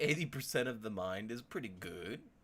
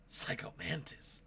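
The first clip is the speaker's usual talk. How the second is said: whisper; 7 semitones higher than usual. Acted. Fan whispering her favorite villain's name. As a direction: breathe the name in awe.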